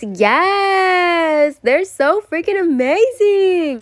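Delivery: high, drawn-out cheer; elated. Real, not acted. Showing off finished nails, high elated squeal. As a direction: stretch each cheer high and long.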